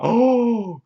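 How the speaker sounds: high, drawn-out "oh" of shock; mock shocked gasp. She learns it's in the house, mock shock. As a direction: cry out high, hamming up the shock.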